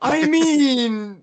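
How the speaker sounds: high, loud, stretched-out reaction; excited exclamation. Real, not acted. Host reacting to the squad idea, loud excited exclamation. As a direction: burst out high and loud, dragging it out.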